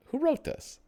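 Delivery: way-high squeaky mock voice; a silly high-pitched who wrote this; squeaky question. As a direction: ask it in a cartoon squeak. Asks twice, first squeaky.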